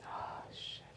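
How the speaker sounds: breathless whisper through tears; a hushed, choked oh shit; whispered curse. Real, not acted. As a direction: whisper it under your breath, overcome. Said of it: "making me a little emotional".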